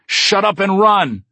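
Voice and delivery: high, loud and slow shout. Acted. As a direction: yell it at him to run. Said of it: "I shout".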